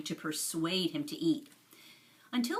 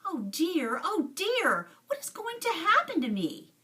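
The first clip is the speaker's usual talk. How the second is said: climbing higher with each oh dear, frightened; panicked boy, voice rising into a wail; miserable wail; 11 semitones higher, 4 dB louder than usual. A narrator's reading. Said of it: "he asked himself miserably".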